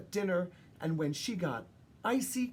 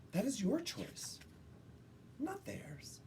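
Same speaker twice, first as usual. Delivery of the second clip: fading into a hushed whisper; soft whisper; 8 semitones higher, 10 dB quieter than usual. Acted. Mothers comforting Maggie, fading to a whisper. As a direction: whisper it as a loving secret. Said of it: "pulled her aside and said".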